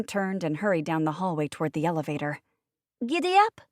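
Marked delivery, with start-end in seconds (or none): 3.1-3.6 put-on high, loud gleeful little-boy shriek; excited child's shout. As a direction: squeal like a child riding piggyback. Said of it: "Kristoff shrieked".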